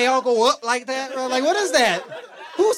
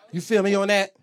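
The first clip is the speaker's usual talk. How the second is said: ordinary talk, nothing marked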